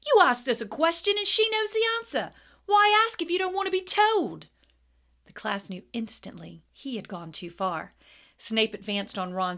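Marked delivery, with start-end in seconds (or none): 0.0-4.5 high, loud, indignant shout; loud outburst. A narrator's reading. Ron defending Hermione against Snape, loud indignant outburst. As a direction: shout back at the teacher. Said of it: "said loudly".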